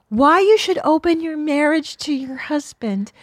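high mocking influencer voice. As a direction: mimic a preachy influencer. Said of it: "she's like".

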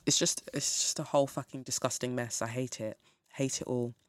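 drops low and soft in disgust; quiet disgust. Real, not acted. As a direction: let the disgust sink into a low mutter. Disgusted rant winding down.

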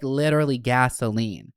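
ordinary talk

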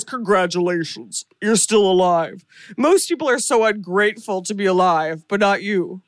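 creepy puppet voice. Acted. Voicing the Jigsaw puppet.

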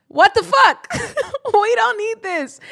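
high, loud exasperated shriek; outraged disbelief. Real, not acted. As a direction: shriek out the disbelief, then slow down. Outraged reaction to Demi show.

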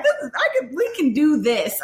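bubbly, invincible after the epidural; giddy. Retold or imitated. To husband and mom, giddy after the epidural kicks in.

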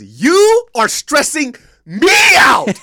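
high, loud, slow mock shout; shouted mom quote. Son imitating his mom blowing up at him, mock shout. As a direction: belt it out like an exasperated mother. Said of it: "She says".

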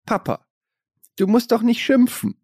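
put-on high, pleading little kid; child's voice. Child quoted scolding her father.

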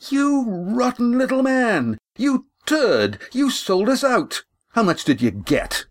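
high, furious accusing yell; furious outburst. Acted. Lindy rounding on the innkeeper, furious high voice. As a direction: spit out the betrayal. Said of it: "Lindy swung the boots".

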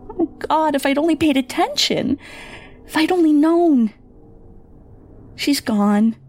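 a guilty mother falling apart; mother breaking down. Acted. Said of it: "As my mother began to cry".

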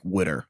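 "witter" for water, low and soft; regional pronunciation. Retold or imitated. Describing Delaware Valley speakers, "witter" for water. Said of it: "literally just say".

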